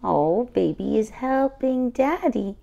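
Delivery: ordinary delivery, nothing marked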